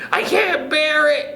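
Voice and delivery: high, panicky wail; frightened cry. Acted. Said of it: "cried Missus Twit".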